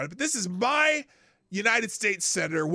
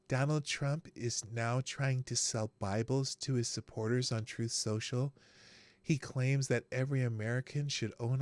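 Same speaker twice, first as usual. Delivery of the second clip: mock Krasenstein read, quieter and lower; 5 semitones lower, 5 dB quieter than usual. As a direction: lower and softer, a droning mock pundit. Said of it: "I gotta do it in the Krasenstein voice".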